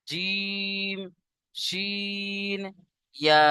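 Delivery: জিম, শিন softly held on a level tone, then ইয়া; mid-tongue letter demo. Shown as a demonstration. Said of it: "জিভের মাঝখান তার বরাবর".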